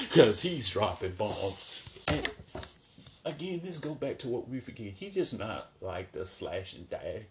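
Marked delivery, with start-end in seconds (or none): none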